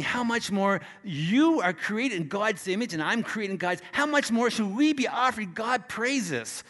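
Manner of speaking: high, driving sermon voice; fervent preaching. Acted. Preacher urging the congregation to use their gift, fervent.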